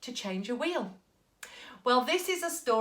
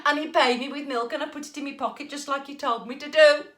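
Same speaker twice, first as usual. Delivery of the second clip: loud, high call home; shout; 3 semitones higher, 7 dB louder than usual. Acted. Jack shouting his news as he stumbles in. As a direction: shout proudly to mum. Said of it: "he shouted".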